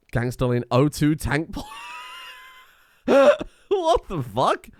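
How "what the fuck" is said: high, slow, laughing incredulity; laughing disbelief. Real, not acted. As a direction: crack up while saying it. Cracks up at a silly animation.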